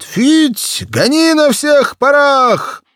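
high, loud, slow hollering call; urging shout. Acted. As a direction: holler at the dog to chase at full speed. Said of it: "засвистал Игнат".

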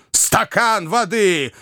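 high, drawn-out mocking echo; fuming gentleman's exclamation; enraged cry. A narrator's reading. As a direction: throw it back furiously, stretching the words. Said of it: "вскричал взбешённый Уордль".

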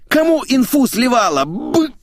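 high, screamed accusation; roaring. Acted. Boss accusing employee of leaking, roaring. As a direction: bellow it in an uncontrolled rage. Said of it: "заревел Сергей".

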